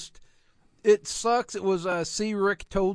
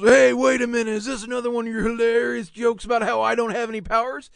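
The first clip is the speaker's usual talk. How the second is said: mock indignant Batman; 3 semitones higher, 6 dB louder, faster than usual. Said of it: "Batman goes".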